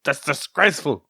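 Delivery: put-on indignant voice; lightly voiced scandalised tone; mock outrage. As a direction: ham up the disgust. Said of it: "and we go".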